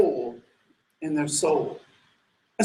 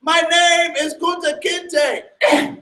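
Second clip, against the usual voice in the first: slow, high, steady defiance; defiant answer; 7 semitones higher, much slower than usual. Retold or imitated. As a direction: hold on to your name. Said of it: "And he says".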